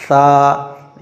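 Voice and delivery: सा with the tongue against the front teeth. Shown as a demonstration. Teacher demonstrating correct सा, tongue on teeth. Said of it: "आगे के दांतों पे लगानी है".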